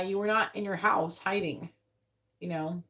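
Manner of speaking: ordinary talk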